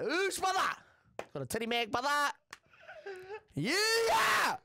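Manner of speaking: high, loud mock celebration; hammed-up delight. Boy celebrates the magazine his mum brought, hammed-up shouting. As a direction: whoop it up like an overjoyed boy.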